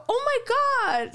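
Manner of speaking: high, drawn-out gush; stretched, high-pitched delighted exclamation; retold gushing. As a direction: gush over an unexpectedly sweet gift. Gushing over the sweatshirt gift.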